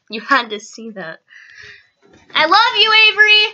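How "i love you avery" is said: high, raised-voice call. Real, not acted. Vlogger to departing little sibling, calling out. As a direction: call it after someone leaving.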